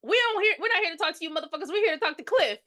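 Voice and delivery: mock shouted demand; retold shout. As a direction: bark it at the table. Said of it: "Brian shouts out".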